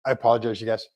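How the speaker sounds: usual speaking voice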